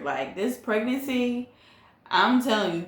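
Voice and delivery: ordinary talk, nothing marked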